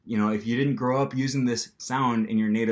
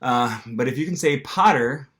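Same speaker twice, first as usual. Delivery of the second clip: ordinary talk, nothing marked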